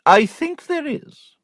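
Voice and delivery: the prim sister confiding to her brother; prim aunt. A narrator's reading.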